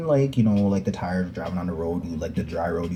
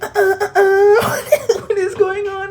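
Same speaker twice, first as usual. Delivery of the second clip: high stuttering, laughing squeak; hammed-up flustered stutter; stammering panic; 4 dB louder than usual. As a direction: stammer in mock shock. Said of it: "I was like".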